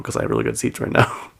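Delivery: normal talking voice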